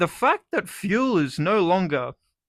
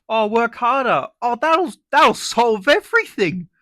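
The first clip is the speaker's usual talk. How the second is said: mocking advice; 6 semitones higher, 5 dB louder than usual. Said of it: "mocking advice givers".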